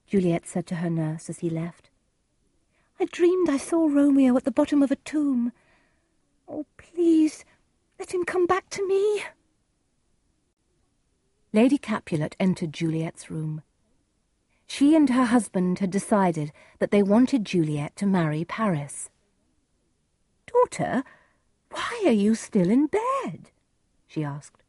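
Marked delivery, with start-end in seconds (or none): 3.0-9.2 high, anxious heroine voice; frightened pleading. Acted. Frightened Juliet tells her nurse dream. 20.5-23.5 high, slow, reproving mother voice; questioning mother. Acted.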